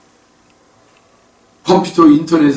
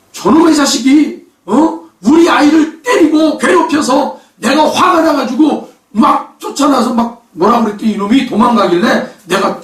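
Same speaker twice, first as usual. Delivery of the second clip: angry mother; 9 semitones higher than usual. Retold or imitated. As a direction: play the furious mother justifying herself. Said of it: "이 아줌마가 하는 말이".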